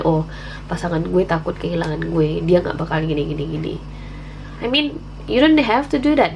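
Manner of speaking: ordinary delivery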